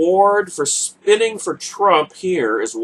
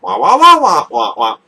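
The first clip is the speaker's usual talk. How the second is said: mock wah-wah; 6 dB louder than usual. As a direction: whine it out like a mock crybaby. Mocking whining noise.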